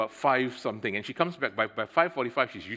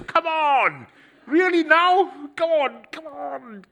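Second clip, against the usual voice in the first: much higher and louder, dragging out an impatient come on; exasperated outburst; 9 dB louder, slower than usual. Retold or imitated. Man stuck behind a coin-counting lady, loud exasperated groan. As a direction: vent loud impatience at a slow queue. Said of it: "no, my first reaction is".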